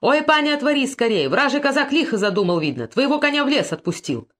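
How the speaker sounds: put-on old servant warning the lady of danger, shouting; old man shouting. A narrator's reading. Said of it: "кричит голосом старого Богдана".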